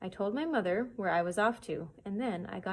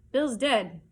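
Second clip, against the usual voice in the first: louder called report; calling out; 3 semitones higher, 6 dB louder than usual. Acted. Pirate calling out to his mates, loud. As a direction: call it out to the others. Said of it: "One called out".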